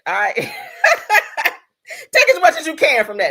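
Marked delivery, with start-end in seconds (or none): 0.4-3.3 loud, high, through laughter; laughing. Real, not acted. Teasing the singer about limited love, laughing. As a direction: crack up while saying it.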